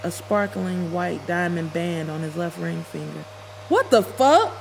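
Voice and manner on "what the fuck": high, loud shocked scream. Acted. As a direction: scream it at him in front of everyone. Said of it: "I screamed in front of everybody".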